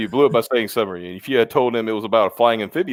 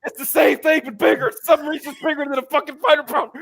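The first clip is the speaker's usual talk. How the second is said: high, pitched-up exasperated rant; 14 semitones higher than usual. Retold or imitated. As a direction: argue it in high disbelief. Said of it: "I said".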